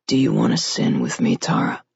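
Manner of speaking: low, intimate male voice; deep male voice. A narrator's reading. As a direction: murmur it close, low and seductive. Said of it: "he asked against her mouth".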